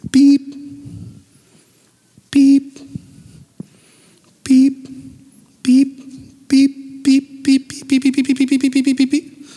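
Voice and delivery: high, loud imitated beeping; metal detector beeps. Preacher imitating a metal detector, high loud beeps. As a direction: beep like a metal detector closing in.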